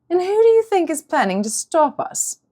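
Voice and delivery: high mocking question; mock her, amused; amused villainess. A narrator's reading. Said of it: "amused glance".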